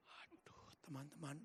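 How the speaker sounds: breathless, moved whisper. Real, not acted. Preacher moved to tears, whispering to the congregation. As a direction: breathe it out, overcome.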